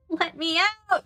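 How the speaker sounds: Eric Andre. Acted. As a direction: parody a desperate meme cry. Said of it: "You know the Eric Andre thing?".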